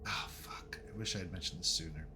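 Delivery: hushed, half-whispered aside; muttered regret. Real, not acted. Game master realizing he told players too late, muttered regret. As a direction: swear under your breath. Regrets not mentioning films sooner.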